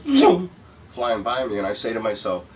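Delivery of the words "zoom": high, loud whoosh of a passing car; zooming car noise. Retold or imitated. Driver mimicking a car flying by him, zooming noise. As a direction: imitate a car whipping past, loud and high. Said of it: "and they go".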